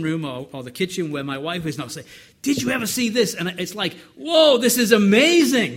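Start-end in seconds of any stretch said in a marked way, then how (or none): 2.4-3.3 high and loud, bursting with discovery; excited. Retold or imitated. Husband bursting in to show his wife a verse, excited. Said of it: "and I'm so excited". 4.2-5.8 much higher and much louder, overflowing wonder; amazed and excited. Retold or imitated. Preacher reliving his joy at a scripture nugget, amazed. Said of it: "and I'm so excited".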